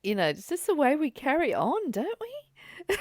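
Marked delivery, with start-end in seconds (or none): 1.9-3.0 high laugh rising out of the question. Real, not acted. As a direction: dissolve into laughter. Laughing at herself.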